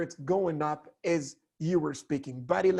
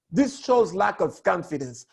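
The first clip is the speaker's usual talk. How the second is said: ordinary delivery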